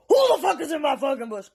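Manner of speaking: angry dad shouting; really loud. Retold or imitated. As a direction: holler it like a dad confronting an intruder. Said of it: "Really loud".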